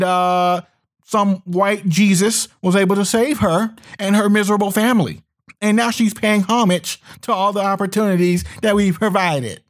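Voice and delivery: hammed-up smug patron voice. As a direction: sneer it out as a smug patron pleased with himself. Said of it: "they're saying".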